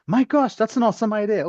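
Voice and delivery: high-pitched acted-out delight; excited discovery. Speaker re-enacts the week's brainstorming, excited. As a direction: react as if a great idea just landed. Acting out reactions at the sticky wall.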